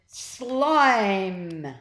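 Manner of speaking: loud, big shouted call; loud shout calling his friend. A narrator's reading. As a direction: bellow it to the rooftop. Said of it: "took a deep breath and shouted".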